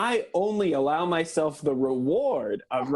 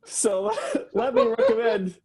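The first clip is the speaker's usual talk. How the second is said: laughing through the words, pitched up; trying to talk through giggles; 3 dB louder than usual. Real, not acted. Presenter recommends a font while still giggling, laughing.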